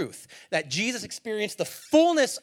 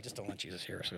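hushed aside; 10 semitones lower, 14 dB quieter, faster than usual. Acted. Disciples whispering among themselves.

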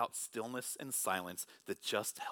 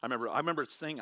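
ordinary talk, nothing marked

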